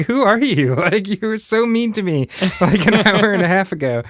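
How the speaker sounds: high, baffled disbelief; who is this person now; incredulous. Retold or imitated. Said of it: "I'm like".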